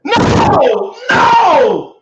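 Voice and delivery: high loud dismayed shout. Real, not acted. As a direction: yell no as it crashes. Host's stream about to restart.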